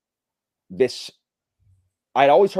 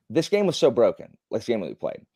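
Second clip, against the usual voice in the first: ordinary talk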